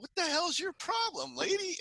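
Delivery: high mock snap back at the neighbor; indignant retort. Jerry snapping back at the neighbor lady, high mock indignation. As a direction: fire it back at her, pitched high. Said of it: "Jerry's all".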